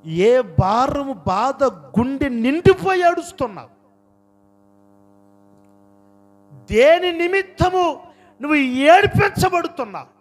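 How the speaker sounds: loud, high impassioned preacher; full-force preaching. Real, not acted. Preacher pressing the congregation about their tears, full-force preaching. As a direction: hurl each question out at full voice.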